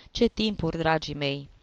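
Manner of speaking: plain reading voice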